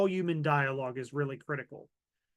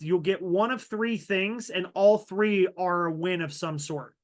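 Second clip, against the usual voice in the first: normal talking voice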